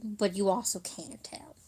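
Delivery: hushed, breathy punchline; whispered aside. Shown as a demonstration. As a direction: whisper it as a joke. Joke repeated, second take whispered.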